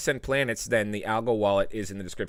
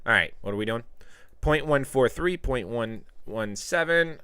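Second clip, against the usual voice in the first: ordinary delivery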